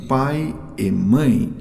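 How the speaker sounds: ordinary talk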